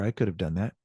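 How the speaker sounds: ordinary delivery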